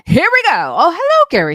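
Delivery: high, loud hype call; hyped announcement. Real, not acted. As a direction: fire up the crowd.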